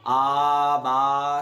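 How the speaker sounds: आ and बा called out loud and clear; loud letter drill. Shown as a demonstration. Teacher calling out letters loudly for a student. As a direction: call the letters out for the class. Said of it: "teacher models letters loudly".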